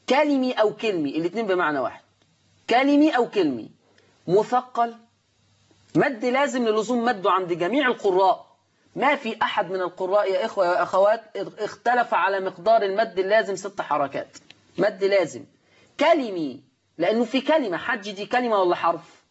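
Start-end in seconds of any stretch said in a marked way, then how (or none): none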